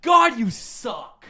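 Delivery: a chef dismissing a cook's best effort; Ramsay scorn. Retold or imitated. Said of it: "So then it's like".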